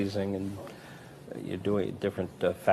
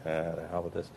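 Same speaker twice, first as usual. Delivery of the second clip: grumble; 4 semitones lower, 7 dB quieter than usual. Retold or imitated. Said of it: "And I'm just going".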